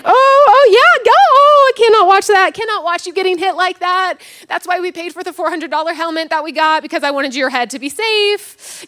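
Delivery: high, loud wailing that gradually eases; fretting mom calling out from the sidelines; anxious football mom. Acted. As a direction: start in a high panicked yell, settle into pleading. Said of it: "which I watched like this".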